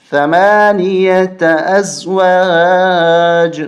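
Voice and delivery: ثمانیة recited as ثمانیةً with a long drawn ending; slow recitation, ثمانیة stretched; recited slowly with pause. Shown as a demonstration. Teacher shows a permitted stop with ثمانیة drawn out. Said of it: "جائز وقف کر سکتے ہیں".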